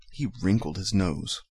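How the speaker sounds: usual speaking voice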